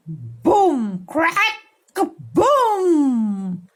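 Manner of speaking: loud booming thunder noise; thunder sounds. Shown as a demonstration. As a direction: make the sky rumble. Thunder sound effects in story.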